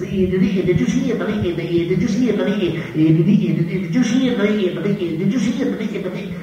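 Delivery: high, frantic cartoon pig stutter; Porky. The guy starts again a third time, Porky-style stutter. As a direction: stammer insistently, pitched well up. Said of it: "talked just like Porky".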